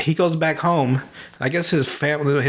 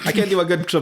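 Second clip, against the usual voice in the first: ordinary delivery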